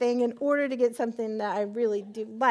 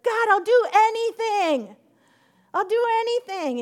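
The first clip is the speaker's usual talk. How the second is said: frantic pleading; 10 semitones higher, 7 dB louder than usual. Retold or imitated. Frantic bargaining with God.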